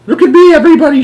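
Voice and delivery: very high, loud gleeful shout; grandma showing off while flying. A narrator's reading. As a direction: crow it to the whole room. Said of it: "shouted Grandma Josephine".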